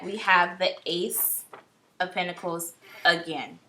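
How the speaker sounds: normal talking voice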